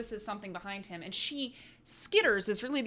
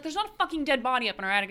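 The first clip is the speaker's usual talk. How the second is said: fast, louder, higher mock anger; husband angrily denying wife's claim, fast and raised; angry denial; 4 semitones higher, 6 dB louder, much faster than usual. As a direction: shoot her down, quick and heated.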